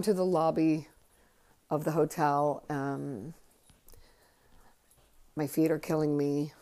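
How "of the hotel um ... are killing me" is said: usual speaking voice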